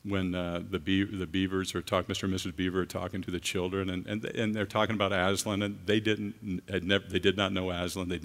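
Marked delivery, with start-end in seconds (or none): none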